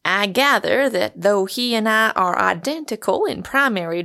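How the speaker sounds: measured, deliberate academic; scholarly pronouncement, slowing as the word is sought; learned doctor, precise. Acted. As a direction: pick each term with pedantic care.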